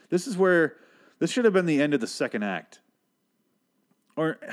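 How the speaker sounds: ordinary talk, nothing marked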